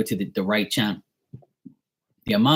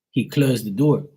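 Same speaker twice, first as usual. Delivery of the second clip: usual speaking voice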